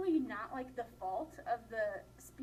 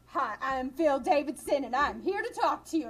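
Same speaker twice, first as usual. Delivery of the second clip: loud, over-eager speech opener; mock a candidate booming out an introduction; imitating passionate speaker; 9 dB louder than usual. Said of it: "He starts off kind of like ... passion".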